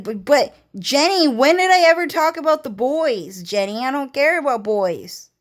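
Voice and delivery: mock-outraged girl pleading; indignant protest. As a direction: defend yourself, exasperated. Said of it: "And then I was like".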